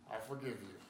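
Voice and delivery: low, soft grudging "I forgive you"; reluctant forgive. Retold or imitated. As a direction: mumble "forgive" like it costs you. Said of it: "takes us a little bit of time".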